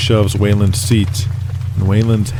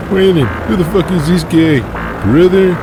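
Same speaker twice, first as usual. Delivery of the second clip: brash champ voice; 8 semitones higher than usual. Acted. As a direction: boast like a heavyweight champ.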